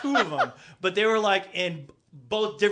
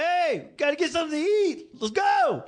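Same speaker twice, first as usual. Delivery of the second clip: higher call up the stairs; calling upstairs; 10 semitones higher than usual. Retold or imitated. Kids calling grandpa from the stairs, raised and high.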